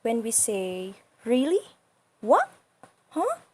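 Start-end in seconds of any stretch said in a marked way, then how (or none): 1.3-3.4 "Really? What? Huh?" each swooping sharply up; "Really", "What", "Huh" as rising questions. Shown as a demonstration. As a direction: ask "Really? What? Huh?" with pitch climbing high. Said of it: "we are asking question".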